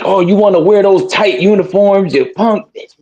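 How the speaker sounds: high-pitched mock grandpa taunt; imitated grandfather needling his grandson. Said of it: "he was like".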